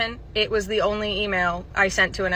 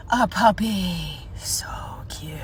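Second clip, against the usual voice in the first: slow, whispered cooing; adoring whisper; much slower than usual. Acted. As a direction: whisper it, melting over the puppy. Acted adoring whisper at puppy.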